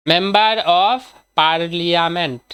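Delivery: very slow, word by word; slow dictation. Shown as a demonstration. Teacher giving students the full form of MP, slow and deliberate. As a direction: stretch each word of the full form, pausing midway.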